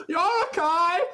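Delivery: panic-babbling to the party; a high, flustered townsman covering himself; high frantic optometrist. Acted. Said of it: "He's like".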